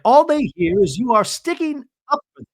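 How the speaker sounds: high, loud, upset imitation of Bronwyn. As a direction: friend mimics Bronwyn arguing with her husband, upset and high. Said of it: "Bronwyn's like".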